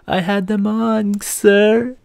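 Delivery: put-on a nervous teen protesting to the officer in a thin high voice; meek protest. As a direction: squeak out a helpless objection.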